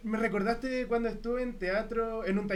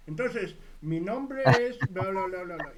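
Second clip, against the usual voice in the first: pencil in mouth; 4 dB louder than usual. Shown as a demonstration. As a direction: say it with a pencil held in your teeth. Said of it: "Tratar de modular muy bien con el lápiz así".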